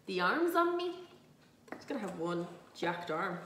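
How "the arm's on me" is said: mimicking a very Dublin accent, pitched up; high, self-mocking Dublin accent; strong Dublin accent. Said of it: "I've never sounded so more Dublin".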